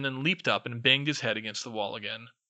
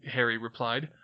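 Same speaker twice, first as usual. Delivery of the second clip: usual speaking voice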